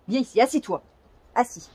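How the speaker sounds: ordinary delivery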